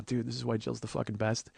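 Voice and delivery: ordinary talk, nothing marked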